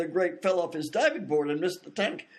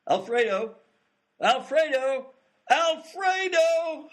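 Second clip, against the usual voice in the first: calls building higher and louder; urgent calling out; 9 semitones higher, 5 dB louder, much slower than usual. Acted. Announcer calling to the fallen diver, rising to a shout. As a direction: call the name, more desperate each time.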